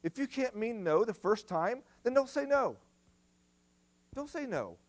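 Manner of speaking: high-pitched, insistent preaching; emphatic rebuke from the pulpit. Real, not acted. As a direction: push it up high, almost yelling. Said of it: "maybe you feel like I'm yelling at you".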